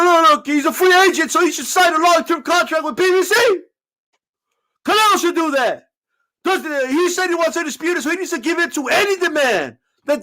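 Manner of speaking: high, loud whiny fan impression, lightly done; mocking fan voice. Mocking PBC fans' argument.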